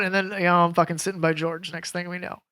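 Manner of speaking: normal talking voice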